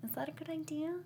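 high, soft, wary questioning; retold doubtful reaction. Viewer reacting to the wedding plan, softly imitated doubt. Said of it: "I was like".

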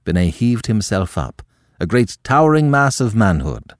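plain reading voice

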